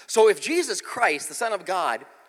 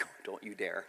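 mock warning; 10 semitones lower, 16 dB quieter than usual. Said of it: "I'm like".

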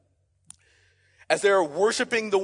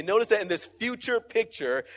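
ordinary talk

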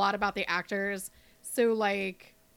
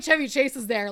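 ordinary talk, nothing marked

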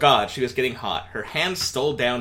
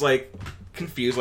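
ordinary talk

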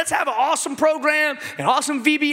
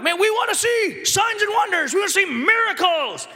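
eager churchgoers; 7 semitones higher than usual. Retold or imitated. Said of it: "People are like".

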